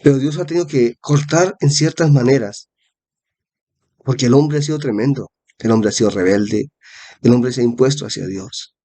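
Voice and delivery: ordinary talk, nothing marked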